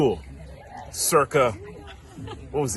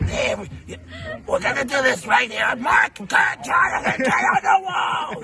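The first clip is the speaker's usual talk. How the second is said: mock smoky-voiced gym teacher, higher, half-shouting; gym teacher impression; 13 semitones higher than usual. Gym teacher calling the class together, smoky mock shout. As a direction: imitate a chain-smoking teacher calling the class. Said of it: "sounded like she ate cigarettes".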